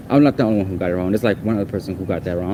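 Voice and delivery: ordinary talk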